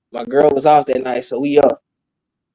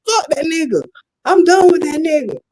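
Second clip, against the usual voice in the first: high-pitched mock scorn; dismissive girlfriend imitation; 11 semitones higher than usual. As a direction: mimic a girlfriend who is fed up. Imitates girlfriend dismissing her boyfriend.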